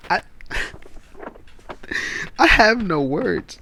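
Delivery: high-pitched laughing disbelief; cracking up, voice squeaking high. Real, not acted.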